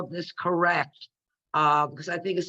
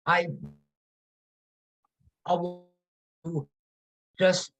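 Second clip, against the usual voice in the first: garbled bad connection; muffled, breaking-up call audio; underwater. Real, not acted. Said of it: "sounds like you're underwater a little bit".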